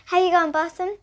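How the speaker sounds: asking mother. Acted.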